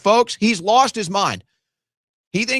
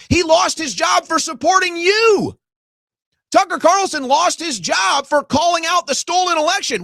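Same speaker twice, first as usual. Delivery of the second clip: angry shout; 6 semitones higher than usual. Acted. Furious rant at Trump.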